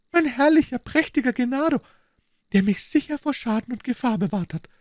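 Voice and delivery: high, rapturous Italian wife praising her husband; proud delight. A narrator's reading. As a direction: glow with pride and adoration. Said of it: "erstrahlte ihr Gesicht in Stolz und Entzücken". (stolze, verzückte Italienerin, hohe Stimme)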